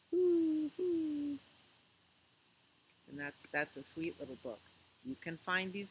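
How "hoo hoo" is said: high, loud hooting owl call. Acted. Reading Good Night Owl to children, high loud owl hoot. Owl hoots ending the book.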